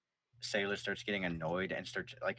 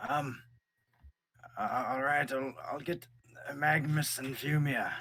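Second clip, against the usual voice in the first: higher, louder stammering fire elemental; nervous stammer; 6 semitones higher, 5 dB louder than usual. Acted. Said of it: "Fire Elemental goes".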